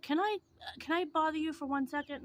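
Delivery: high, sugary imitated plea; sweet asking voice. Imitating the beggar asking for a second, high sugary voice. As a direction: play up the beggar's polite high voice. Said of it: "she was like".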